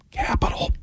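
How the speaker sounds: hushed whisper. Retold or imitated. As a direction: breathe the words with no voice. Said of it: "and she whispered".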